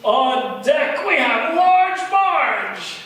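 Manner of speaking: loud, drawn-out emcee call; announcer voice. Acted. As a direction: boom it out like a show host. Emcee introduces next act.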